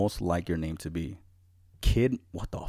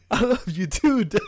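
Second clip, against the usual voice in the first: laughing; 13 semitones higher, 6 dB louder than usual. Retold or imitated. Laughing through quote.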